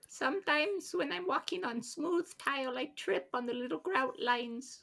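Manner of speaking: tiny high falsetto, softened; little guy voice. Acted. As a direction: confess it as a teeny helpless creature. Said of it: "your best little guy voice".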